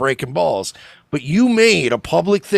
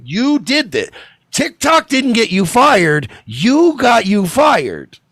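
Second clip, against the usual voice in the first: higher, louder, slowing scold; emphatic scolding; 9 semitones higher, 5 dB louder, slower than usual. Real, not acted. Host blaming the nurse for her firing, slow emphatic scold. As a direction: hammer home that she's to blame.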